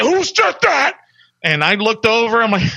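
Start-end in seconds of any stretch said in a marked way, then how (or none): 0.0-1.0 high, sharp drill instructor demand; mock drill instructor snapping at recruits; drill instructor bark. Drill instructor demands who spoke.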